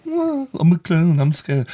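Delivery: high, slow mock victim voice. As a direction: sneer it like a taunt. Mocking a clown prank victim.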